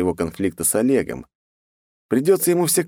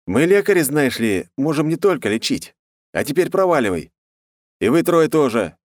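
commanding; 7 semitones higher than usual. Acted. Hero dismisses attackers.

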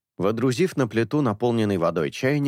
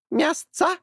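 high squawking bird. A narrator's reading. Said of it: "осведомился Орев". (птичий скрипучий голос)

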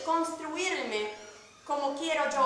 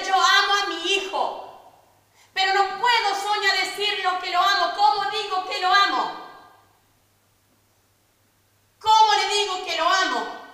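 loud, pained plea; retold anguished cry; 5 semitones higher, 8 dB louder than usual. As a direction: cry out a mother's anguish, voice raised. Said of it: "ella gritaba". (gritando de dolor, como madre desesperada)

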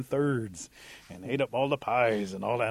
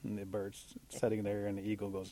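ordinary delivery

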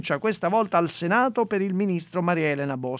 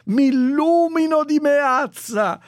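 higher, louder gushing praise; mock fan praise; 6 semitones higher, 5 dB louder, slower than usual. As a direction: cheer like an adoring fan. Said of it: "un profluvio di".

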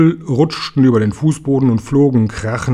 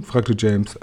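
plain reading voice

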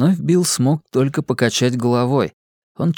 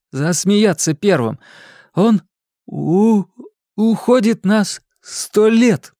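put-on stuttering boy; 7 semitones higher than usual. Bill stutters through explanation.

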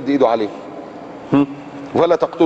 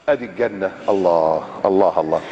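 awed sigh; 10 semitones lower, 3 dB quieter than usual. Acted. Awe at seeing paradise.

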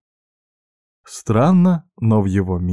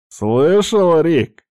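raised, high jeering call; 8 semitones higher, 5 dB louder, much slower than usual. A narrator's reading. Bald thug calling to his pal behind him, loud and jeering. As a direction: call it over to your mate.

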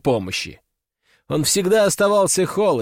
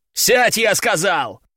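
furious shout; 5 semitones higher, slower than usual. A narrator's reading. Gang boss shouting at his hysterical son, slow furious roar. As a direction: bark it as an order you won't repeat. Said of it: "закричал главарь".

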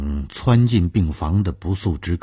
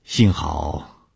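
ordinary delivery, nothing marked

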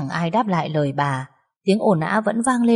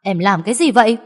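stern raised rebuke to his wife; angry husband; 4 semitones higher, 6 dB louder than usual. A narrator's reading. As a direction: bark at her to stop. Husband stops fighting wife. (chồng quát vợ)

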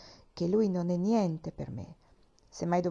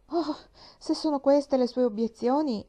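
mischievous tone; 6 semitones higher, 4 dB louder than usual. A narrator's reading. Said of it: "con tono malizioso".

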